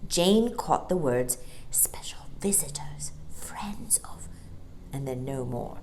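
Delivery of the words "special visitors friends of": soft secretive whisper. Acted. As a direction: whisper it into his ear. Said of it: "the second seal whispered again".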